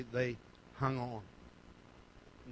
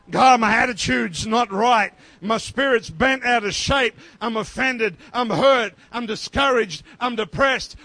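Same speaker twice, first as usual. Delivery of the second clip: loud, raised preacher's cry; impassioned preaching; 4 semitones higher, 10 dB louder than usual. Acted. Preacher crying out to God for cleansing, impassioned and loud. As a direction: preach it at full force, punching each phrase.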